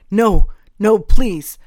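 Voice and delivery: loud, pleading cry; panicked plea; desperate begging. A narrator's reading. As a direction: beg them to stop. Cornered man begs to stop.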